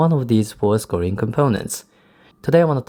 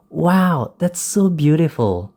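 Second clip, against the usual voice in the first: delighted quote, lifting higher on the exclamation; happy excitement; 5 semitones higher, slower than usual. Shown as a demonstration. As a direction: demonstrate happy amazement with a raised pitch. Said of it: "illustrates a feeling of happiness or excitement".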